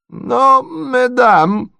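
very high, protesting cry; prince crying out. A narrator's reading. Prince cries out to quarrelling ladies, high and protesting. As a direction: call out in a high old-prince voice. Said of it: "закричал князь".

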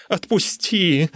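high, pleading whine; whining. A narrator's reading. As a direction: whine to be let go. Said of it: "заскулил я".

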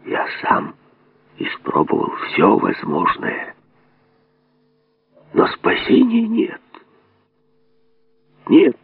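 hushed, breathy whisper in her ear. A narrator's reading. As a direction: lean in and whisper it. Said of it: "он прошептал ей на ухо".

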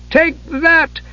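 high, bold battle cry; defiant kender cry. A narrator's reading. Tas stabbing the giant's toe, defiant. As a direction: strike with a cheeky cry. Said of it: "With a Kender cry of defiance".

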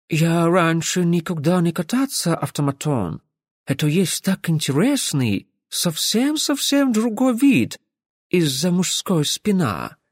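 чуть выше, с ломаным акцентом; восторженная иностранная барышня; голос иностранки. Acted. Реплики мисс-иностранки. (иностранка восхищённо делится)

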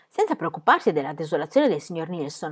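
usual speaking voice

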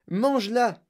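high, eager command; high-pitched call; shouted. A narrator's reading. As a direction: cry it as an excited urging. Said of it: "cria monsieur Wonka".

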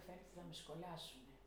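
quiet, resigned fear of being fired; worried murmur. Retold or imitated. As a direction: say it under your breath, expecting the worst. Fearing she will be fired. (φοβισμένη ότι θα την απολύσουν, σιγανά)

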